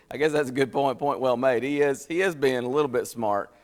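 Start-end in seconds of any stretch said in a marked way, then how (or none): none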